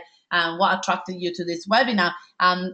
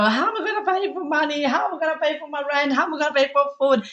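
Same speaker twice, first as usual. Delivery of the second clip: rushed, higher, worried inner voice; retold panic; 6 semitones higher, faster than usual. Recalling her early business fears to attendees, mock panicked. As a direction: replay the money panic of a new business, quick and high. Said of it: "It's pretty scary".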